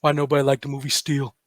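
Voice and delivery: low, soft, deadpan question; quoting laid-back Shaq. Retold or imitated. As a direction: ask it quietly and low, as a chilled-out big star. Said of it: "He's like".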